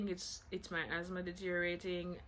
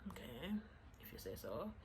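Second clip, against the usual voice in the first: unconvinced mumble; 2 semitones lower, 9 dB quieter than usual. Retold or imitated. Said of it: "So I'm like".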